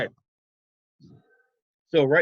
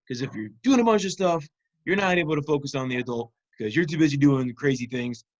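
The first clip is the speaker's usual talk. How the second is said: ordinary delivery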